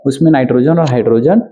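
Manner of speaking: ordinary talk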